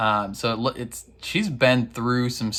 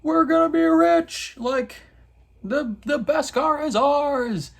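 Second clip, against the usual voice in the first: very high, loud mock squeal of greedy thugs; hammed-up high-pitched gloating; 4 dB louder than usual. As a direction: shriek it like a cartoon goon who just struck it rich. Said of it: "and they're like".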